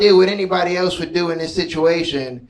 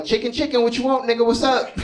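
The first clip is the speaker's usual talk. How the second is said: higher, rowdy drive-thru greeting; rough Popeyes worker; 7 semitones higher than usual. Retold or imitated. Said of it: "they was like".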